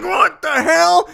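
high, slow, alarmed what the hell; startled. Retold or imitated. Startled by a horn while driving, high and slow. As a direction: jump at a phantom honk. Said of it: "I'm like".

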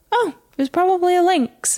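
high, breezy imitation of a casual stranger; calm lady. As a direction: shrug it off like it's nothing. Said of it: "she was so calm about it".

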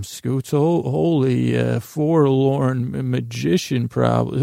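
normal talking voice

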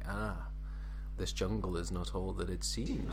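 low, hushed murmur of discovery; whispering to himself. Acted. Explorer holding a cable vine, whispering to himself. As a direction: say it under your breath, soft and low. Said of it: "just whispering to himself".

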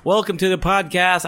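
high, upbeat welcome to the listeners; smooth podcast intro. Real, not acted. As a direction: open the show like a pumped-up host. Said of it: "I did that so smoothly".

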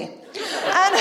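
ordinary talk

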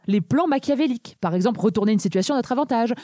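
ordinary talk, nothing marked

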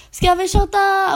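high, loud, slow party call; high-pitched drunken shout for shots; drunk excited call. Retold or imitated. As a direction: call out to everyone for shots, tipsy. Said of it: "Jag bara".

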